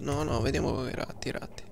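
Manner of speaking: usual speaking voice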